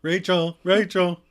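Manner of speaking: acted calling for someone. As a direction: call her name out to the next room. Acting Ross calling out for Rachel.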